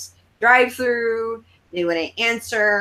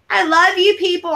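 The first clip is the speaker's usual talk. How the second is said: stage call; 9 semitones higher, 5 dB louder than usual. Retold or imitated.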